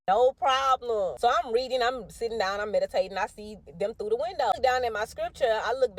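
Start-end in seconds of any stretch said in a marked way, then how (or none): 0.1-1.2 loud, drawn-out easy assent; emphatic reassurance. Retold or imitated. Mother tells her son he can skate, emphatic reassurance.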